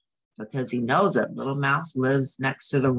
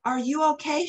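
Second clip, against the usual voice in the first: high, raised call over the storm; shouted; 11 semitones higher, faster than usual. Acted. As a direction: call out to Mouse through the wind. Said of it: "shouted Bear".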